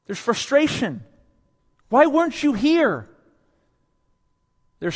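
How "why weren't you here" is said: much higher, loud frustrated cry; frustrated Martha. Acted. Martha frustrated at Jesus for being absent, in a high, loud cry. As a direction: fling the question at him.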